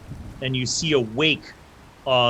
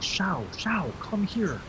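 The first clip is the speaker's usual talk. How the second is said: high, softer motherly call; 8 semitones higher, 5 dB quieter, slower than usual. Acted. Mother calling Shao as they pass, high hushed call. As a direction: beckon your child over, high and hushed.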